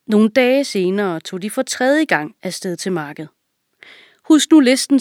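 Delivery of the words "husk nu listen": high, loud king's reminder; commanding king. A narrator's reading. King reminds the servant of the list, loud and high. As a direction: drive the order home. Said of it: "sagde kongen".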